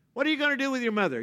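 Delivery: high, fast rhetorical challenge; fast rhetorical question. Real, not acted. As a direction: fire the question at them.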